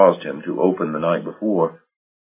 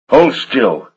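sharp, furious order; forceful command; 7 semitones higher, 4 dB louder than usual. Acted. York ordering Marsh to freeze in the dark, forceful. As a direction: stop him dead with the words. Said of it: "York commanded, with such force and fury".

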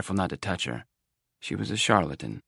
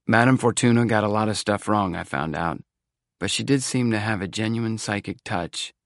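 usual speaking voice

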